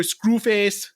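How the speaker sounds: high, hammed-up Jamaican accent; bad Jamaican accent. Podcaster naming the film's villain, mock Jamaican accent. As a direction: put on a cheesy Jamaican accent. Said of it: "adopt a bad Jamaican accent".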